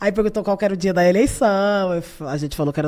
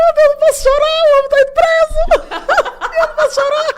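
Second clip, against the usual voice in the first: very high, loud, teary squeal; hammed-up sobbing panic; mock panicked crying; 7 dB louder than usual. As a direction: wail it like fighting back tears.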